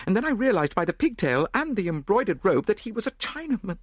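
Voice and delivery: high-pitched shaken lady's voice; distressed Lady Yardley. A narrator's reading. Lady telling how she was robbed, high distressed voice.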